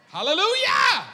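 a big high joyful shout; shouted hallelujah. Real, not acted. Pastor cheering the Warriors win to the congregation, a big joyful shout. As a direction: belt it out like a cheering fan.

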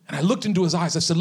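ordinary talk, nothing marked

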